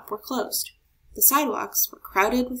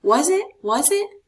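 put-on higher, louder impatient kid; eager shout; 7 semitones higher, 7 dB louder than usual. A narrator's reading. Marco pestering Mama about the surprise, high eager shout. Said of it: "Marco shouted".

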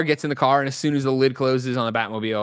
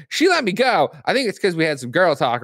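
high, louder retold plea; mock damsel calling out in a higher voice; pleading princess; 4 semitones higher, 3 dB louder than usual. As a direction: play the princess pitched up. Ice Princess quote, pleading high.

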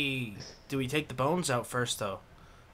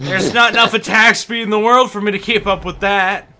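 loud exasperated; 7 semitones higher, 12 dB louder than usual. Real, not acted. Exasperated during fast gameplay.

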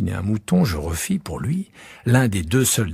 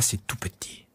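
"c'est tout petit" breathed as a soft whisper; 10 dB quieter than usual. Acted. Prince whispers tiny home.

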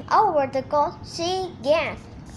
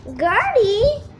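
called out higher and louder; crying out a name; 7 semitones higher, 5 dB louder than usual. A narrator's reading. Gazelle calling to Gertie, crying out. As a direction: hail her from up the hill. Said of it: "cried Gary".

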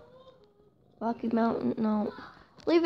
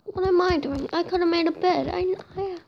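self-scolding; 6 semitones higher, 4 dB louder than usual. Real, not acted. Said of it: "I'm so stupid".

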